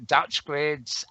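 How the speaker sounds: ordinary delivery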